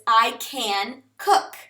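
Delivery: slow, deliberate example sentences. Shown as a demonstration. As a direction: say them slowly so learners follow. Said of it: "For instance".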